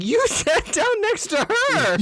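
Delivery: very high, drawn-out laughing protest; incredulous outburst. Acted. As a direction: squeal the accusation in amused outrage. Indignant comeback to the character's excuse.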